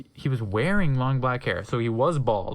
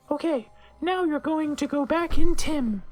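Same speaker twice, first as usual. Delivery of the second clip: much higher, louder, odd put-on voice; high, squeaky professor voice; high teacher voice; 12 semitones higher, 4 dB louder than usual. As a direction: chirp it like a quirky witch teacher.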